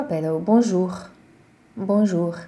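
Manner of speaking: bonjour as bonʒu, last letter silent; French bonjour. Shown as a demonstration. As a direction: drop the final r of bonjour. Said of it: "Utolsó betűt nem ejtjük".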